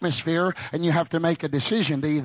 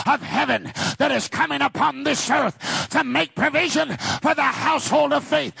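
fervent prayer; 7 semitones higher than usual. Retold or imitated. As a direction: cry out to heaven for food. Retells a missionary's prayer.

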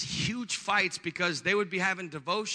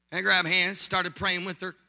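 usual speaking voice

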